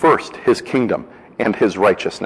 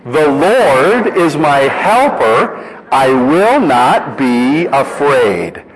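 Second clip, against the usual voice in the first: slow, louder and higher bold declaration; with confidence; 5 semitones higher, 4 dB louder, much slower than usual. Shown as a demonstration. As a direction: proclaim it boldly, every word. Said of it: "this time with the confidence".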